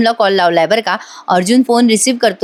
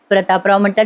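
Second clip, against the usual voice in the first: ordinary talk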